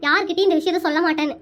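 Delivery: ordinary delivery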